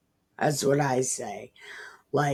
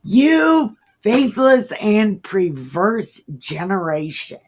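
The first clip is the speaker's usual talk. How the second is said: exasperated Jesus; 5 semitones higher, 6 dB louder than usual. Acted. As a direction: sigh it out like a fed-up teacher. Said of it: "And Jesus answered".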